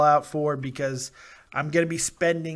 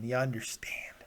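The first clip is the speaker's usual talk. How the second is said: whisper; 4 semitones lower, 10 dB quieter than usual. Acted. Whispered aside.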